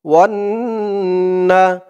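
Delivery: normal talking voice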